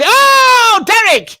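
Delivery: high, loud cry of the name; excited shout. Acted. Medium spotting Derek, a loud high shout. Psychic greets caller.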